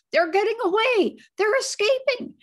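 high, loud excited shouting. A narrator's reading. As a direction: cheer them on, shouting. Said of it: "shouting with excitement".

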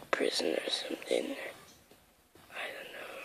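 breathy unvoiced whisper. Real, not acted. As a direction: whisper while hiding. Whispering while hiding.

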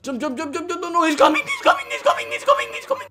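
very high, frantic squeals; high-pitched panicked warning. Real, not acted. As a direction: shriek as the enemy closes in. Panic in game.

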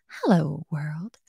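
hushed whispered hello world. Pretend. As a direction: breathe it like a secret. Whispered take of the phrase.

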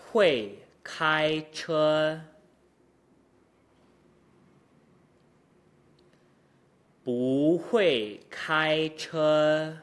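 very slow, every syllable held apart; exaggerated slow drill. Shown as a demonstration. Teacher drilling back to front at natural pitch, very slow. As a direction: stretch each tone out deliberately. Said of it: "exaggeration and slow speech".

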